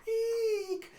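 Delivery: very high, thin "eek"; pretend scared squeal. King fleeing commoners, pretending to be scared squeal. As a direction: fake a frightened squeal. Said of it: "pretending to be scared".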